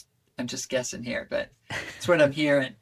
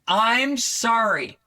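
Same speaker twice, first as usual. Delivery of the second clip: loud, slow, higher resentful sorry; harsh sorry; 8 semitones higher, 7 dB louder, much slower than usual. Shown as a demonstration. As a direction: spit out a grudging apology. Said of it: "if you have to say you're sorry, this one will probably go better than".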